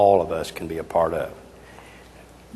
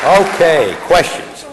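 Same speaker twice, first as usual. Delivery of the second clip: raised, higher call over the crowd; loud, higher call inviting questions; calling out to the room; 9 semitones higher, 7 dB louder, slower than usual. Real, not acted. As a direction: grab the room's attention for questions.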